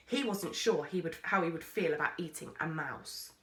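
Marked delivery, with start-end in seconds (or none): none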